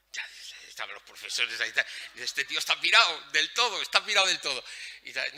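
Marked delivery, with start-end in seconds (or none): 2.2-4.6 loud, higher-pitched mimicry of teachers; exclaiming teacher. Speaker voices teachers calling the visitor crazy, loud exclamation. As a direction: exclaim it as a disbelieving onlooker.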